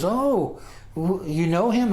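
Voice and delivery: high-pitched imitation of an impressed girl; mock impressed surprise. Girl to the teller she snubbed, mock amazed interest. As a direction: squeal it as her sudden starstruck interest.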